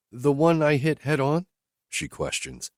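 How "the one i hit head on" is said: higher, lightly voiced woman asking bluntly; higher woman's voice, questioning her driver; Whitney's voice. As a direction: lift into her voice, direct and searching.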